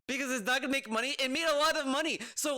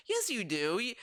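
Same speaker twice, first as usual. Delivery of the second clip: normal talking voice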